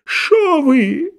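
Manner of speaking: high-pitched howl of protest; thin-voiced wail. A narrator's reading. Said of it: "взвыл тонкий голос". (тонкий высокий вой)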